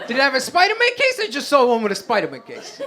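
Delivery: high-pitched, eager question; lightly voiced Guido, squeaking up at first; mock helpful Guido. Guido asking about a lost house like an iPhone, mock helpful. Acting cousins' lost-phone exchange.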